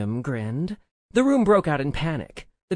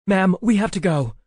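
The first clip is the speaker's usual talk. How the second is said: raised, louder guard's call; urgent yell; 5 semitones higher, 7 dB louder than usual. A narrator's reading. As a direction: yell to get her moving. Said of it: "a guard of theirs yelled".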